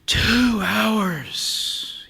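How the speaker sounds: put-on high, breath-filled teenage whine; bored teen groaning about the wait; complaining. A narrator's reading. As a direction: drag out the complaint, each word full of breath. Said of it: "he complains, filling each word with breath".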